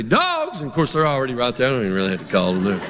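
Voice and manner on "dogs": calling the dogs. Retold or imitated. Preacher calling his dogs to the food bowl, high call. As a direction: call them in to eat, pitched way up. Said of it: "What I do is I say".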